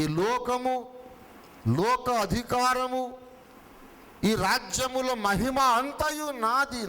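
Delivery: preaching at full force. Acted.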